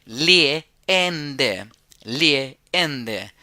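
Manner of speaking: "leende" with a long stretched first E, short second e. Shown as a demonstration. Teacher showing students the long vowel, slow and drawn out. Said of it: "es una E larga".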